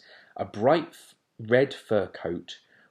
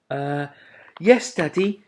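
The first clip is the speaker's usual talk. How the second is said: meek owlet; 7 semitones higher, 7 dB louder than usual. A narrator's reading. Plop meekly.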